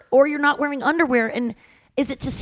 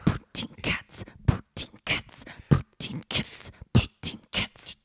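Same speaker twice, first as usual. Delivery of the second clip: whispered beatbox, unvoiced and slow; beatbox the words in a breathy rhythm; 7 dB quieter, slower than usual. Shown as a demonstration. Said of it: "Can you beatbox".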